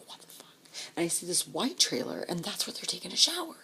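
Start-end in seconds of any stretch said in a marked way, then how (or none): 0.1-0.5 softer, breathy what the fuck; whispered shock. Retold or imitated. Narrator seeing RVs in camp, whispered shock. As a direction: say it under your breath.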